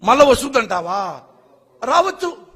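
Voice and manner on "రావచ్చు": high, mocking maybe; sarcastic reply to a reporter. Real, not acted. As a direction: toss it off with scorn. Sarcastic echo of question.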